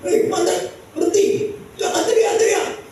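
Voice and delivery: very high-pitched order to stop; scolding crowd shout. Acted. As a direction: shout down someone who is yelling. (bentakan orang banyak yang melarang)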